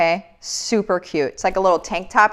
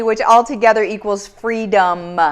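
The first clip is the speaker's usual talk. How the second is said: ordinary talk, nothing marked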